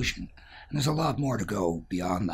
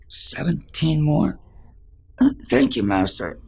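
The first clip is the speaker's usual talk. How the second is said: higher, louder, slow stunned echo; dismayed shock; 8 semitones higher, 6 dB louder, slower than usual. Acted. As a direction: reel at the number. Old man shocked by answer.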